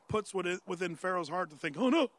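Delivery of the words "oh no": higher, louder mock-worried "uh-oh"; mock alarm. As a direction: play a ruler's sudden dismay lightly. Pharaoh's alarmed thought, acted out.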